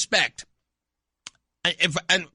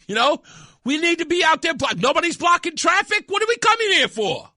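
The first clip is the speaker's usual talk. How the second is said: high, loud mock whine; hammed-up indignant complaint; 10 semitones higher, 5 dB louder, faster than usual. Reporter loudly whining about a peaceful protest.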